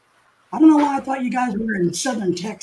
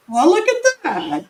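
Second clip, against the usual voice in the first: much higher and louder, delighted; pleasantly surprised; 11 semitones higher, 5 dB louder than usual. Real, not acted. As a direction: react to an unexpected gift. Surprised by a super chat.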